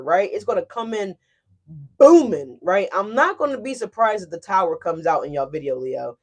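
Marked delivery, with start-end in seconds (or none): 2.0-2.6 a big loud booming word. Shown as a demonstration. Tarot reader telling Leos it will roar, booming loud. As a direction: boom it out like a roar. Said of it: "it's gonna roar".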